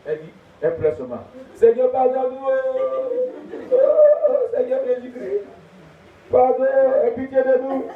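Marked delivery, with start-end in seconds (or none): 1.5-7.9 pardon lancé haut et fort; prière suppliante. Acted. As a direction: supplie Dieu. Woman pleading to God. (femme qui supplie Dieu, voix haute et forte)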